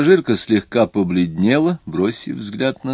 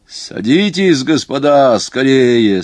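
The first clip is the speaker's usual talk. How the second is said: loud, high, urgent order; calling out; 5 semitones higher, 7 dB louder, slower than usual. A narrator's reading. Conductor orders passengers aboard, calling out. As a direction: hurry them aboard with a raised voice.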